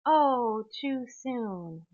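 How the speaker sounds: high, drawn-out mock sympathy; bleeding-heart sympathy. Bleeding heart consoling a man over his grandfather, exaggerated sympathy. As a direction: imitate a gushing bleeding heart, loud then easing. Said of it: "kind of bleeding heart ahead of him".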